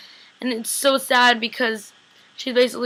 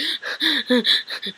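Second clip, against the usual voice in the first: imitated breathy panting gasps; mock someone breathing way too hard; exaggerated hard breathing; 2 semitones higher than usual. Imitating Elena's reaction to Damon's touch, exaggerated panting. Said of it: "breathing so hard".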